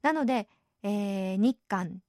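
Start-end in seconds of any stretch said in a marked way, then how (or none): none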